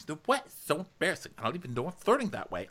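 very high, girlish mock embarrassment; squeaky caricature, easing down and speeding up; hammed-up coy protest; Ramona. Acting Ramona's embarrassed answer.

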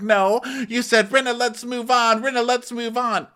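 high mock quote; hammed-up mimicry; mock high retort. Garcelle correcting Rinna, high mock retort. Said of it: "And she said".

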